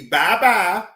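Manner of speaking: high, loud singsong bye-bye; mocking high farewell. Streamer mimicking YouTube kicking him off, high singsong. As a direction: sing the goodbye like a taunting kiss-off. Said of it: "they most definitely told me".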